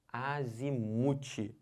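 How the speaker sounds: "Azimuth" drawn out slowly, stress on MU. Shown as a demonstration. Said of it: "Azimuth é o mu, que é a sílaba tônica". ("Azimuth" devagar, com a tônica no MU)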